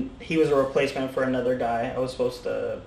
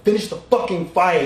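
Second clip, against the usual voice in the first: raised-voice mock yell, punching each word; forceful retold yell, a bit higher; imitated coach's yell; 4 semitones higher than usual. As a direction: act out the coach yelling an order. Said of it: "when I say Matt yelled".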